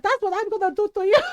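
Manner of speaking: high, quick mock-furious threat; retold angry threat. Mom threatens tita in quarrel.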